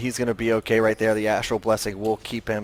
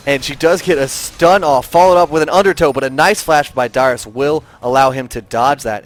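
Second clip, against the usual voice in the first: excited, punchy match commentary; live play-by-play; 3 semitones higher, 7 dB louder than usual. Real, not acted. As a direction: call the fight as it erupts.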